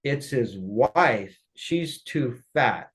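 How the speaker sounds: dragged out, slow and deliberate; slow retold verdict. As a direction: spell out the insult slowly, word by word. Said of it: "He said".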